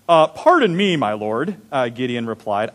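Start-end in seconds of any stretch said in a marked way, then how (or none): 0.1-1.6 mock British. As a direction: play Gideon as a lightly hammed-up Brit. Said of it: "Maybe he was British".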